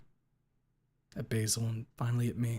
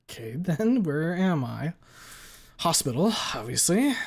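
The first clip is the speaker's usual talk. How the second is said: louder, strained, higher; in pain; 5 semitones higher, 4 dB louder than usual. A narrator's reading. Narrator waking in hospital, gasping through pain. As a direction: push through searing pain.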